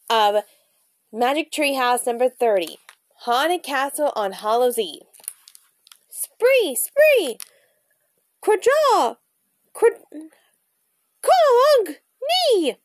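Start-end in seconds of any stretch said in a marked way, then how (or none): 6.1-12.8 high, loud bird squawks; raven croak. A narrator's reading. Teddy turned raven speaking Raven, high squawking croak. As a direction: cry out in sharp raven caws. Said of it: "Teddy croaked".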